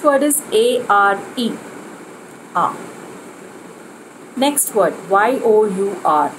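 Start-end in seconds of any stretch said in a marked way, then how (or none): none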